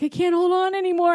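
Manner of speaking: high, straining panicky whine; Rex. Retold or imitated. Toy dinosaur losing his grip, high mock desperation. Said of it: "Rex was like".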